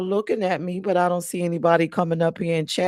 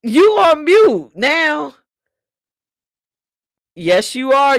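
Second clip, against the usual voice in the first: calling out; 9 semitones higher, 9 dB louder, slower than usual. Real, not acted. Calling to a muted guest.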